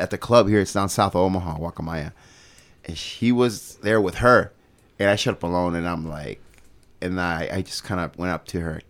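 ordinary talk

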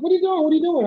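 high, quick repeated question; acted high-pitched catching-up; excited questioning. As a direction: imitate a buddy pleased to bump into you. Acts out friend's eager questions.

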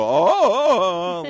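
high, loud ape hooting; gorilla call. Retold or imitated. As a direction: hoot like a chest-beating gorilla. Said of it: "And they go".

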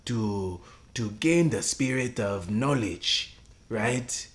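put-on loud, solemn African accent. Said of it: "my African accent isn't as good as yours".

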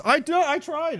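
high squeak of mock protest; defensive protest. Real, not acted. Streamer answers a chat joke about jail. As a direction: yelp it defensively.

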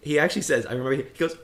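normal talking voice

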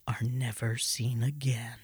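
dropped to a hushed, spooky whisper; ominous hush. Acted. Host reading a chilling statistic to listeners, hushed whisper. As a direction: let the last words fade into a creepy whisper.

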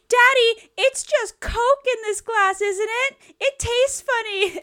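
put-on very high, loud, whiny girly plea; high slow pleading teen-girl whine; mock teen girl. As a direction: whine to daddy like a bratty teen, slowly. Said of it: "teenage girl is like".

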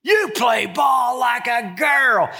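high, slow sneering playground taunt; kid's taunt. Retold or imitated. Catcher taunting the smart aleck kids, high slow sneer. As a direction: drag it out like a bratty kid.

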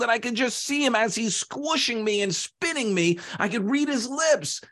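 high-pitched, agitated telling; distressed clay. Acted. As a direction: keep the panic up in a high pitch. Clay tells of its ordeal.

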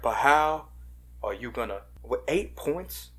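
lightly voiced Charles Barkley drawl; a hint of Barkley, slowing at the end; Charles Barkley impression. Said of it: "can you do that Charles Barkley accent again?".

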